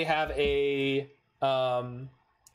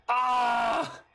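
high anguished yell of frustration; frustrated cry; 9 semitones higher, 3 dB louder than usual. Real, not acted. Player yelling as the opponent's card ruins him. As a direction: let out a raw cry of defeat. Card wrecks his board.

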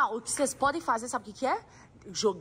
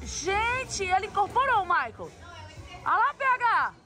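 high, thrilled commentary; excited; 6 semitones higher than usual. Real, not acted. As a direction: cheer a friend's dance moves. Friend dancing like Michael. (animada e empolgada)

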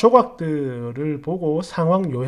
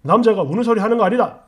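loud, fast, higher-pitched telling-off; father's scolding; 5 semitones higher, 7 dB louder, faster than usual. Retold or imitated. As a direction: imitate a stern father laying down the law. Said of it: "이러면서 막 뭐라 했단 말이지".

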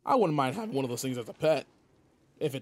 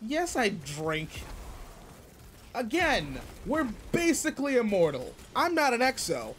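much higher, rising, talking himself into it; defensive; 6 semitones higher than usual. Acted. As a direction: justify yourself, getting more worked up. Defending his drinking habits.

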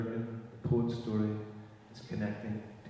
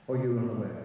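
usual speaking voice

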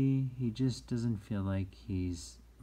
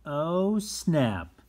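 loud, high oh falling onto snap; exclaimed dismay; 7 semitones higher, 7 dB louder than usual. Acted. Reading out a mistake to children, exclaimed dismay. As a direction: call it out like a sudden mishap. Exclaims turning page of book.

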